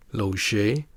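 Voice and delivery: "loger" ending in a long "ay", R silent. Shown as a demonstration. Teacher gives borrowed French word, long E ending "loger". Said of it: "pronounced as a long E".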